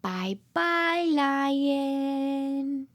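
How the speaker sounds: ordinary delivery, nothing marked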